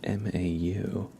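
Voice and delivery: ordinary delivery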